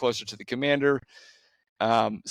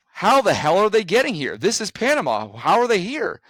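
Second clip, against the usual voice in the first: much higher and louder, stunned; can't believe they got here; incredulous disbelief; 8 semitones higher, 4 dB louder than usual. Real, not acted. Disbelief at migrants from afar.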